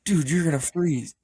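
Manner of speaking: retold alarm, voice lifted; imitated freaking out. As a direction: replay the friends' urgent warning. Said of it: "They were, like, freaking out".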